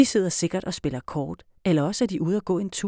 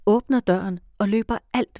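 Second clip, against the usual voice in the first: ordinary delivery, nothing marked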